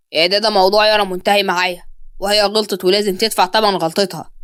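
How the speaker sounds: low, firm male character voice; stern master of the house. Acted. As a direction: drop the pitch and refuse firmly. Voices Murad refusing the nanny.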